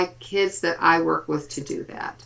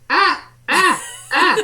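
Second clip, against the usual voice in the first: put-on high, loud baby wail of ah ah; imitating baby crying; 8 semitones higher, 4 dB louder, slower than usual. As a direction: mimic a fussing toddler's cries, pitched way up. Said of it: "she is in the back seat going".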